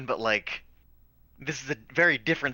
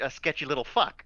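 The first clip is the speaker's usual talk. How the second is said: ordinary delivery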